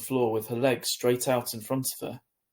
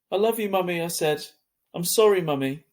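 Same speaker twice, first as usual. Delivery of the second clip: high, louder farewell; tearful call; 6 semitones higher, 5 dB louder than usual. A narrator's reading. Boy calling love and sorry to Mum, high and louder. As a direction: call out to her as she goes.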